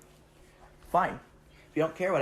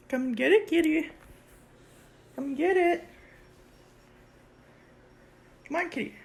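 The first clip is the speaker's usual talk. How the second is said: high, loud coaxing call; luring the cat; 10 semitones higher, 4 dB louder than usual. Real, not acted. Owner luring the cat to its food, high and loud. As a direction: lure the cat over eagerly.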